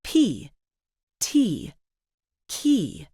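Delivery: "P, T, key" each with a puff of air; aspirated. Shown as a demonstration. Teacher has learners feel the breath on their palm, aspirated "P, T, key". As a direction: aspirate the first sound of "P, T, key". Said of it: "with a little puff of air".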